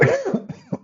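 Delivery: a sharp high cough; sudden throat cough; coughing fit. Real, not acted. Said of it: "I'm coughing".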